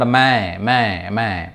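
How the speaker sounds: మై repeated with the vowel pushed through the nose; nasal main. Shown as a demonstration. Teacher modelling nasal మై for online class. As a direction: drill మై three times, nasal "main". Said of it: "దీన్ని ముక్కుతో పలకాలన్నమాట".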